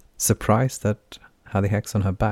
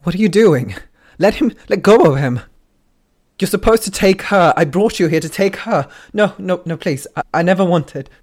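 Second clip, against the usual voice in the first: desperate screaming plea; 11 semitones higher, 9 dB louder than usual. Acted. Dying plea to the Ender.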